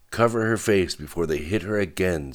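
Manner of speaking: plain reading voice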